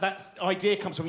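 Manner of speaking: ordinary talk, nothing marked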